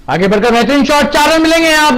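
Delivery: high, loud burst of excitement; excited commentary. Real, not acted. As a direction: call the boundary with a thrill. Boundary shot, commentator erupts. (उत्साहित commentary)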